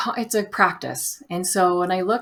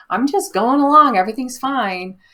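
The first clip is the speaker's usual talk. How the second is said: higher, louder, sing-song carefree mockery; playful mock cheer, higher and louder; mock breezy; 6 semitones higher, 7 dB louder than usual. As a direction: parody someone pretending all is fine. Mocking a carefree attitude.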